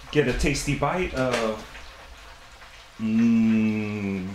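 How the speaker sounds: usual speaking voice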